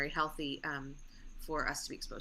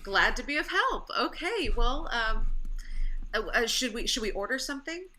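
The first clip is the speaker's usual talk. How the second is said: high flustered voice; 6 semitones higher, 5 dB louder than usual. Acted. Nurse character high voice.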